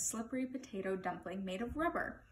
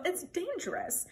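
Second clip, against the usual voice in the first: high-pitched pleading warning; 8 semitones higher than usual. A narrator's reading. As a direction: plead with mild urgency. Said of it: "pleaded Bartholomew".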